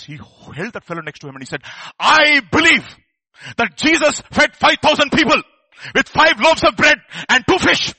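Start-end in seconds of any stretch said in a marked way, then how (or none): 2.0-7.9 high, full-force shout of belief; crying out loud. Retold or imitated. Man defying the demon of unbelief, loud cried-out declaration. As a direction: shout each phrase out with total conviction. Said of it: "he cried out with a loud voice".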